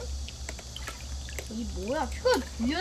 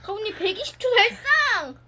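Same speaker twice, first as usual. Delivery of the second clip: higher, proud call of the record; excited announcement; 6 semitones higher than usual. Real, not acted. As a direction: announce the win with a cheer.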